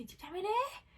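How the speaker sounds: high, rising question in alarm; retold alarmed cry. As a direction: imitate a frightened sister catching her. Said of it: "وقعدت تصوت وتعيط وتترعش".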